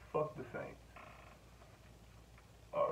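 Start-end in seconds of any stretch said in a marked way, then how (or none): 0.1-0.8 quiet sincere. Real, not acted. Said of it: "That's deeply from the bottom of my heart".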